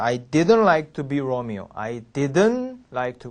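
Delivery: slow "didn't" with the T dropped, like "didn"; slowly, swallowing the T in "didn't"; silent T. Shown as a demonstration. 선생님 시범, "didn't"의 T를 묵음으로 천천히. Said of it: "안 들립니다".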